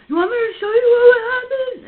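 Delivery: high, loud hammed-up shouting; mock yell. Boyfriend yelling at her before he speeds off, mock angry yell. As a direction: throw his angry challenge back at her in a mock yell. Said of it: "He's all".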